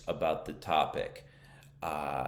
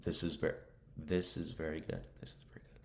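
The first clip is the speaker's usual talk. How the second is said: softer repetitions, fading to a whisper; practicing to oneself; 7 dB quieter, faster than usual. Shown as a demonstration. Learner practices pronunciation alone, quietly repeating to oneself. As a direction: rehearse the phrase under your breath, trailing away. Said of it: "I'm practicing my pronunciation".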